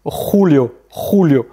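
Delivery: Julio said khou-lio, throaty J; guttural Spanish J. Shown as a demonstration. Teacher drilling the J, Julio said khou-lio. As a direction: scrape the start of Julio in the throat. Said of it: "quelque chose de plus guttural".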